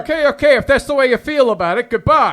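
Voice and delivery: fed-up shouting to hang up; angry shout. Acted. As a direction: slam the goodbye. Rick ends the quarrel.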